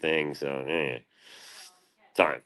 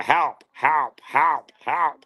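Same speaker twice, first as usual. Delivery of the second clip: high, squeaky repeated help; short robot yelps, one after another; game robot cry; 10 semitones higher, 4 dB louder, much slower than usual. Retold or imitated. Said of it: "I just took that".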